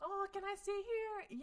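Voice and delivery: high, innocent mock voice; mock sweet request.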